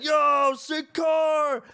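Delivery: high, loud shouted yell; screaming. Retold or imitated. Strangers scream at the car owners across the lot, loud yell. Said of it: "they're, like, screaming it".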